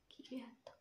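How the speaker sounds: pained whisper, slow. Real, not acted. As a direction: whisper through sore lips. Lips hurting after lip challenge.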